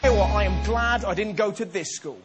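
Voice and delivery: usual speaking voice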